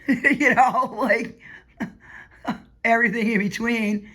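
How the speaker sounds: laughing through the words. Real, not acted. Telling grandma about family, laughing. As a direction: chuckle as you list them off.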